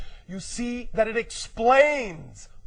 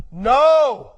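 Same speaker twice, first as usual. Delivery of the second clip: loud, higher, punched KNOW; emphatic repeat; 4 semitones higher, 8 dB louder than usual. Real, not acted. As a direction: drive the word home loudly. Repeats key word after asking "What?.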